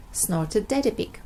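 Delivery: usual speaking voice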